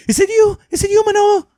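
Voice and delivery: high-pitched, loud calling through a locked door; mock frantic girlfriend. Boyfriend retelling girlfriend calling through locked door, high and loud. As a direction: play the scared girlfriend shouting from the bedroom.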